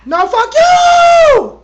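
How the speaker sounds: drawn-out, high yell; slow, loud screaming; shouting. Real, not acted. Trash-talking a bird.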